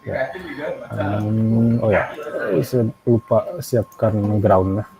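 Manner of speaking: normal talking voice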